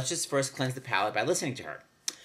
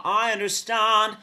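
flat fifties vowel in "understand", slow and higher; slow, higher fifties Broadway accent; 8 semitones higher, 6 dB louder, slower than usual. Retold or imitated. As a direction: flatten "stan" toward an ah like fifties talk. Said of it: "It's almost an ah".

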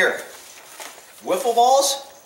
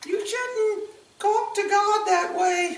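high, drawn-out mocking voice; upset religious scold; 11 semitones higher, 4 dB quieter, slower than usual. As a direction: mimic an offended churchgoer in a high voice. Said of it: "religious people always gets upset when I say".

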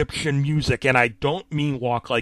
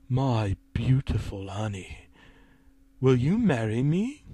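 villain's proposal; 3 semitones lower, 4 dB quieter than usual. A narrator's reading. Villain proposes in narrated story.

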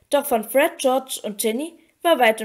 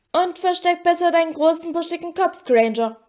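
higher-pitched taunt; jeering; 6 semitones higher, 3 dB louder than usual. A narrator's reading. Malfoy taunting Granger as she leaves, jeering. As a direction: jeer it after them. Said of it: "höhnte Malfoy".

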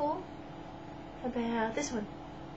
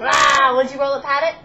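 excited cheer; 2 semitones higher, 11 dB louder, much faster than usual. Real, not acted. Excited parent cheering baby.

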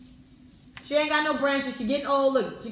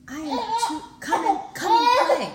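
high, loud, laughing crone voice; hammed-up old tree cackling and pleading; cackling old woman; 12 semitones higher, 6 dB louder than usual. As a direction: ham up a feeble old lady pleading. Old tree begging boy, laughing.

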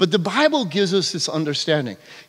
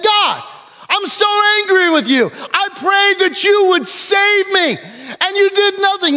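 angry complaint; 12 semitones higher than usual. Acted. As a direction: hurl the blame at God. Dead man confronts God angrily.

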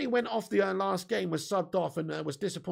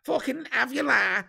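hammed-up Scouse, pitched higher; thanking a viewer who praised his accent, in higher mock Scouse; 6 semitones higher than usual. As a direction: lay on the Scouse like a Liverpool lad, higher. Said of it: "Great Scouse accent".

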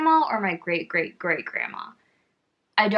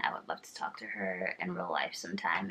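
soft wistful whisper; 13 semitones lower, 11 dB quieter than usual. Real, not acted. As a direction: breathe it like a secret. Dreamy aside about meeting her.

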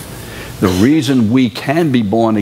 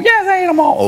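put-on high, sing-song child's voice insisting yes; mock child's reply; faster than usual. Preacher playing child answering parent about peas, high mock voice. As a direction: play the kid fibbing about his peas, pitched high.

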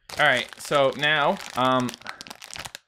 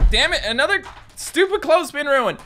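frustrated outburst; 11 semitones higher, 4 dB louder than usual. Real, not acted. Clothespin falls off, frustrated.

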